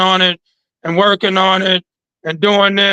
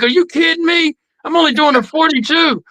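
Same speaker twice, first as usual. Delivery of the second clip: incredulous; 8 semitones higher than usual. Retold or imitated. Shocked at facing a bigger truck.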